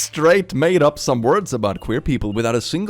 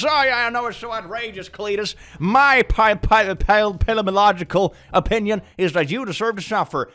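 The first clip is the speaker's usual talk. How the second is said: sarcastic; 7 semitones higher than usual. Pretend. Mocking a conservative's complaint.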